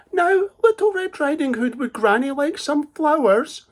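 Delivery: high, sugary wheedling voice; sly wolf. Acted. Cunning wolf sweet-talks the girl, high and wheedling. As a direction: coax her sweetly, hiding the trap.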